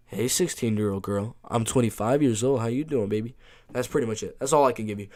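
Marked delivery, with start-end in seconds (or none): 0.1-3.4 low, soft mock pickup voice; Pat Barry. Streamer repeating the requested impression for chat, lightly voiced Pat Barry. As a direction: lightly imitate a fighter's come-on, quieter and lower. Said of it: "Pat Barry impressive. Again, just".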